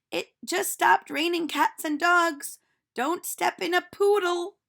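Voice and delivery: put-on a cheerful teacher calling out to children; silly teacher voice. Teacher speaks.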